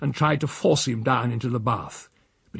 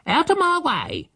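high, sharp bossy housekeeper; housekeeper ordering. Acted. Housekeeper to Silas and the boy while carrying boiling water, high bossy order. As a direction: shove him aside with words. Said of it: "she ordered".

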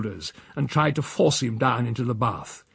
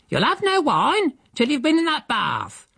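high, sharp refusal; tart housekeeper. Acted. Housekeeper to Silas, refusing his wine, high and tart. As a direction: slap him down like a stern nanny.

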